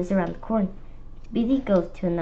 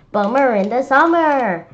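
loud, higher sing-song groan; exclaiming; 5 semitones higher, 9 dB louder than usual. A narrator's reading. Narrator groaning about the late trick-or-treat time, loud and high. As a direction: sing out the letdown.

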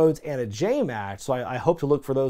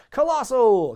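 high, loud mock battle cry; imitated yell; 9 semitones higher, 5 dB louder, slower than usual. Reviewer mimics wrestler before his corner avalanche, mock yell. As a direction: yell it like a wrestler's signature call. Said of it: "he just yells out".

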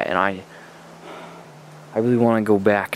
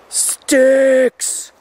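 much higher, louder yelp; panicked shout; 12 semitones higher, 7 dB louder than usual. Acted. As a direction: yell it out in sudden fright. Panicked at discovery.

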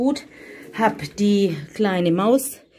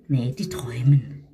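ordinary talk, nothing marked

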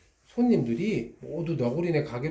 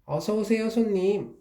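higher, bright shopkeeper greeting; excited welcome; 8 semitones higher than usual. Acted. Fox shopkeeper welcoming a customer, excited and higher. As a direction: greet the customer eagerly, voice lifted. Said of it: "여우는 신이 나서 손님을 맞이했어요".

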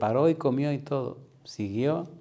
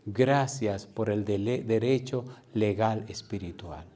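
prayer; slower than usual. Real, not acted. Preacher thanking the Lord for his word, reverent prayer. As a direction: bring it down into a measured prayer.

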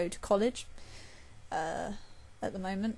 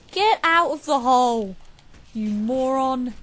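angry yell; 3 semitones higher, 7 dB louder than usual. Real, not acted. Yelling at teammate in game.

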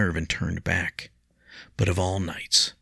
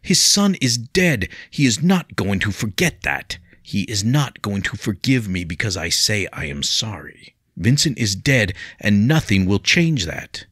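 loud, higher anguished insistence; heated; 4 semitones higher, 6 dB louder than usual. A narrator's reading. William to Leah about the dead son, heated and loud. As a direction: hammer home that it's final. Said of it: "William cut her off heatedly".

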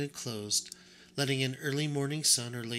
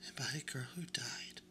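sad hush; 12 dB quieter than usual. A narrator's reading. Edward recalls a dead girl.

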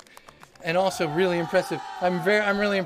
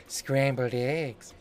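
low, slow sultry purr; slow, low teasing come-on; 6 semitones lower, much slower than usual. Acted. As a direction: make breakfast sound seductive. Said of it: "That's not how you normally talk".

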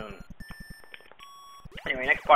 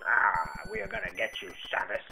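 mock villain; 7 semitones lower, 6 dB quieter than usual. Voices an enemy threatening Samus.

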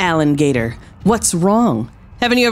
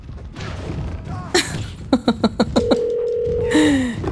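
long, breathy fit of laughter; 6 semitones higher, 5 dB quieter than usual. Real, not acted. Streamer cracking up at the wrestling game, laughing. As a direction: laugh it out at length, mostly without voice.

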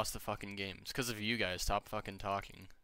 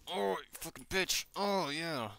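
much higher, sudden cry at the game; real shock, pitched way up; startled outburst; 9 semitones higher, slower than usual. Real, not acted. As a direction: yelp it out as you get hit. Getting killed in the game.

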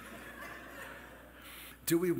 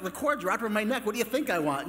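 fast, higher and louder, fed up; exasperated patient; 5 semitones higher, 4 dB louder, much faster than usual. Retold or imitated. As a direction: rattle it off as a patient at wits' end. Exasperated patient answers the nurse.

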